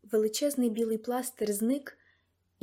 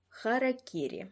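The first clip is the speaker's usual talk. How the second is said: ordinary delivery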